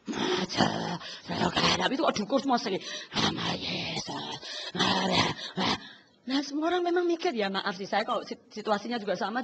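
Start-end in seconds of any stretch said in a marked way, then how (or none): 0.1-5.3 very low rough broken voice; demo of hoarse voice. Shown as a demonstration. Preacher shows her half-healed voice singing. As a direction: rasp it like a voice barely coming back. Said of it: "masih berat".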